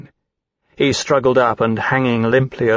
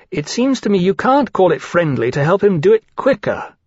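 higher-pitched, mean-spirited jibe; spiteful; 6 semitones higher than usual. A narrator's reading. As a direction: needle them with a spiteful objection. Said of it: "this was only spiteful meanness".

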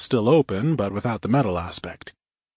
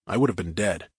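usual speaking voice

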